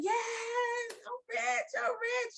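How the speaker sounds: very high, elated scream without full volume; re-enacted joyful scream. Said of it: "So I'm screaming".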